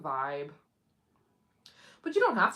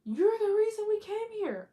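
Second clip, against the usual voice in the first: high, louder excited traveler voice; travelers' quote; 6 dB louder than usual. Retold or imitated. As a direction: gush it like a grateful fan. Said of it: "They're like".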